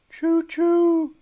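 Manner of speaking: high, loud choo choo call; train whistle. Pretend. Hype train ends.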